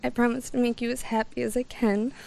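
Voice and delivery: usual speaking voice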